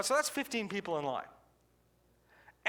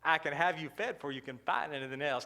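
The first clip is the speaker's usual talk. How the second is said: sly waitress; 7 semitones lower than usual. Retold or imitated. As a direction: lean in low like a waitress with a secret. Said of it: "she said".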